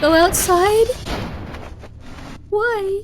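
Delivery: high rising alarmed cries; panicked. Real, not acted. Panicked reaction to game.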